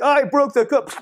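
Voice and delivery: squeaky high little voice; high silly mock quote.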